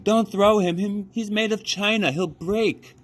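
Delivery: high, loud desperate plea; high loud pleading girl voice. A narrator's reading. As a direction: beg them not to throw him. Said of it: "cried Abilene".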